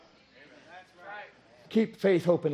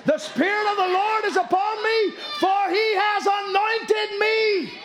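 higher, louder proclaiming, slowing at the end; 12 semitones higher, 3 dB louder, slower than usual. Acted. Preacher crying out scripture over the wailers, loud proclamation. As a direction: declare it like a bold herald. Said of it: "There's a wailer".

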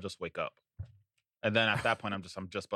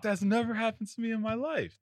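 much higher, put-on incredulous voice; high mock coworker voice; 12 semitones higher than usual. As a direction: squeak it like a baffled coworker. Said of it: "It's like".

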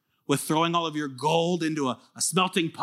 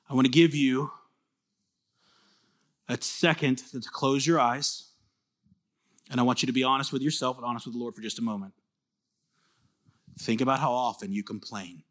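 quiet reflection prompt; 5 semitones lower, 4 dB quieter than usual. Acted. Asks them to close their eyes.

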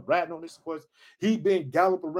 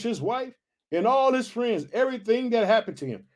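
measured Bible narration, slightly raised pitch; scripture reading; 4 semitones higher than usual. A narrator's reading. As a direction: read the passage out to the congregation. Reads scripture aloud.

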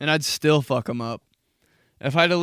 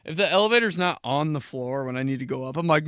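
normal talking voice

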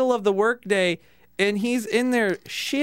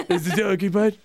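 disguised voice. Retold or imitated. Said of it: "Trying to disguise his voice".

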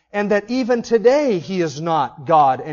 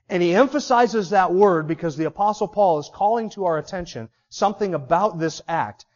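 usual speaking voice

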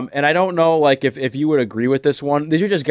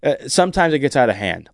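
ordinary delivery

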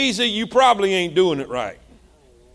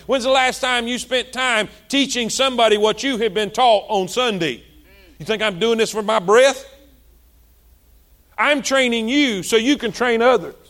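ordinary talk, nothing marked

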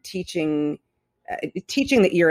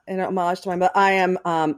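normal talking voice

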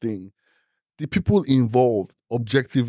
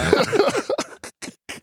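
high laugh. Real, not acted. Joke about Oppenheimer family.

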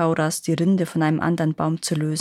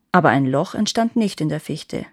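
plain reading voice

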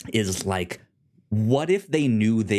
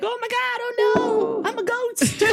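very high, hammed-up ghostly wail; mock panic. Co-host mimics the falling figure with arms up, mock panic. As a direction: squeal it in pretend alarm. Mimics a dropped flailing character.

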